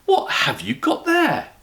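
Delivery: very high, slow demanding; shrill shock at the bear; a startled housekeeper demanding; astonished. Acted. Mrs Bird astonished.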